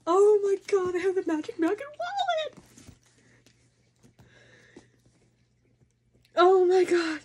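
high, overjoyed squealing; excited squeal. Real, not acted. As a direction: squeal with real delight over the wallet. Squealing over bag and wallet.

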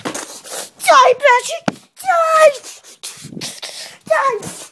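high loud battle cry; shouting. Pretend. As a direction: scream it at the toy fighter. Toy fight cheering.

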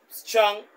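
strong hit high and loud; stress on strong. Real, not acted. To listeners, lecturing on genes, strong punched loud and high. Lecturing on bright and dunce gene.

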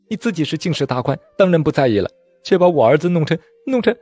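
high, upset accusation breaking off; tearful reproach. A narrator's reading. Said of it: "眼看表姐哭泣不休". (带着哭腔高声埋怨)

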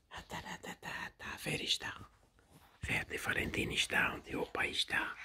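hushed tender whisper. Real, not acted. Grandparent whispering to baby.

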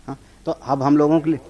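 usual speaking voice

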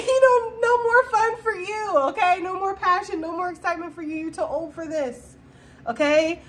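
high mocking nag, lightly voiced; mock nagging voice. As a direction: sneer it like a naysayer telling you you're old. Mocking naysayer, pitched high.

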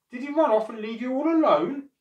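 high, loud, outraged query; acted old man's indignation; indignant question. A narrator's reading. Old man indignant about absent dog.

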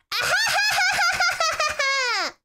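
loud, high shouted villain cackle; evil laugh. Acted. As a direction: belt out a maniacal laugh. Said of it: "evil laugh".

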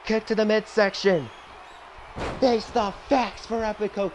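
wrestling commentator. Acted. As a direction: hype up the finish like a TV announcer. Back to calling the match.

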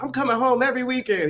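high pleading voice; mock teen pleading. Son promising his father he'll come home, high mock plea. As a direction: plead like a homesick kid.

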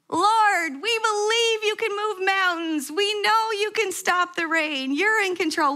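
a retold shouted prayer, pitched high. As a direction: call it out to the sky as if to stop the rain. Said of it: "We just started shouting".

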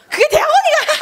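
high, laughing jeer; scornful laughing. Retold or imitated. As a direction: imitate a relative scoffing through laughter. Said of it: "하면서 비웃더라고". (높은 소리로 웃으며 비웃듯)

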